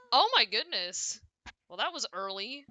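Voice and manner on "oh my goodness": loud, slow, high startled cry; startled exclamation. Real, not acted. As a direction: jump at the scare. Sudden ambush in game.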